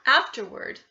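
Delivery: ordinary delivery, nothing marked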